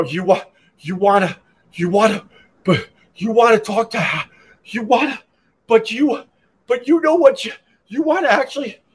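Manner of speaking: flustered, high and stammering; panicked. Retold or imitated. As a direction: stumble over words in a panic. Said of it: "they panicked".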